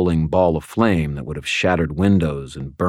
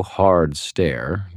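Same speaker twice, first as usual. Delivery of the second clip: ordinary delivery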